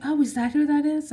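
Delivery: a sudden dawning "oh"; husband's realization. Retold or imitated.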